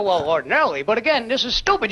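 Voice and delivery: mock-patient movie-makers' answer; low filmmakers voice. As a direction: drop low and state the obvious. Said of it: "And they go".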